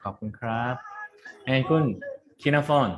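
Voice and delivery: normal talking voice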